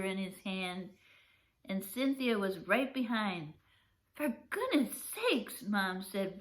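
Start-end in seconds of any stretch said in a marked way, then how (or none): 4.1-5.6 startled mother. Acted. Startled mom scolds her son.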